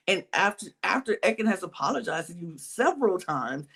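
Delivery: ordinary talk, nothing marked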